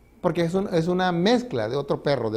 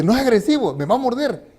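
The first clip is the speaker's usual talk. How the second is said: put-on scared young man; 6 semitones higher, 3 dB louder than usual. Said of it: "y ve al perro y dice".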